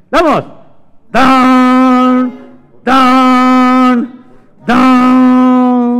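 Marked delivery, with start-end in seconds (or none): 1.1-5.9 three loud, long, high tolls; clock chime. Acted. Clock striking three, booming imitated chimes. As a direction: ring out like a big bell. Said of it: "dio las tres".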